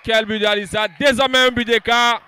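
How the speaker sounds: loud, high goal call; excited sports commentary. Real, not acted.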